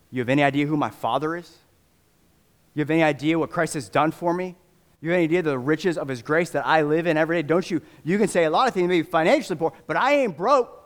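a poor believer building to a fierce protest; defiant believer. Retold or imitated. Macedonians protest to the preacher, indignant rising shout. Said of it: "they say".

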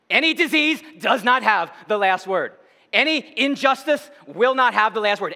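high, insistent, driving sermon climax; triumphant pulpit declaration, phrase after phrase; forceful preaching. Real, not acted. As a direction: hammer out each item of the list.

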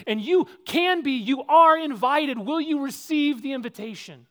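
loud, high, ringing pulpit delivery; fiery preacher driving the point home; full-force preaching. Acted. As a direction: preach it hard to the whole room.

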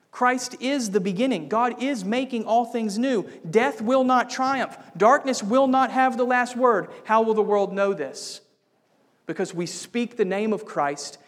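loud, high ringing proclamation; preaching at full force. Acted. Preacher declaring Christ's triumph to the church, loud and soaring. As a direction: proclaim it like a victory shout. Climax of the sermon.

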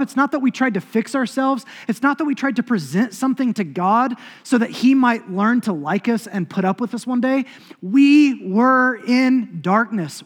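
high, loud, impassioned preaching; preaching at full force. Acted. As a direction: build to the sermon's peak, proclaiming. Preaching climax.

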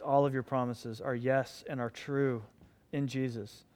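soft, low closing prayer; hushed pastoral prayer. Acted. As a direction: pray quietly over the congregation.

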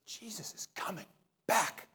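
hushed, awestruck; awed whisper. Retold or imitated. Listener leaving church amazed by Jesus, awed whisper. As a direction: breathe it in wonder. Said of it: "I want it to be".

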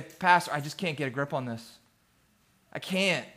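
quietly retold struggler, voice sinking low; a hushed, understated admission of struggle; mild defeated confession. Man confesses his struggle to his pastor, mildly defeated.

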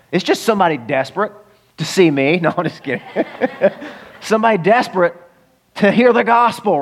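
ordinary talk

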